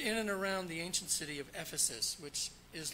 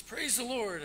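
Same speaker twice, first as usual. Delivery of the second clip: mock preacher call; 6 semitones higher, 5 dB louder than usual. Preacher commanding his horse.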